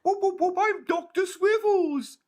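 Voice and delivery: high, loud zany whooping; Three Stooges voice. Acted.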